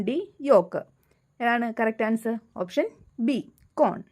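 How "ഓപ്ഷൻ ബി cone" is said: ordinary talk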